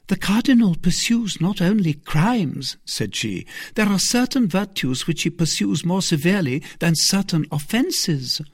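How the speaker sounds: a hint of a probing mother superior; the abbess. A narrator's reading. Abbess speaks of the cardinal.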